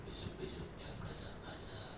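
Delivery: soft whispered advice. Retold or imitated. Pastor whispers advice to a sick man, soft whisper. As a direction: whisper it into his ear. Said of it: "Saya bisikin".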